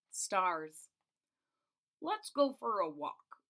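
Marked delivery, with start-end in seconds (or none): 2.0-3.4 slow, gently voiced old man's suggestion; Mister Putter. A narrator's reading. Mister Putter inviting Tabby, lightly voiced, slow. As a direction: invite the cat out for a stroll. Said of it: "Mister Putter said".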